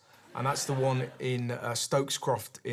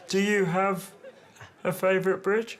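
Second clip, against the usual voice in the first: higher, stiff mock of the caller; imitating stilted voice; 5 semitones higher than usual. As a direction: parody the stiff question. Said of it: "Very stilted".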